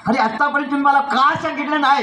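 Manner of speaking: high-pitched, hurt questioning; acted anguished question, pitched high; distressed reproach. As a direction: demand an answer as the upset little sister. Mukta learns parents are dead.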